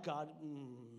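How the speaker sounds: low, softened grumble to God; retold exasperation. Preacher retelling his reaction to snow, held-back exasperation. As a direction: drop low and swallow the complaint.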